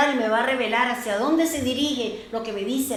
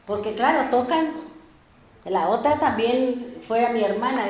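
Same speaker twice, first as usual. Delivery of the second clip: ordinary talk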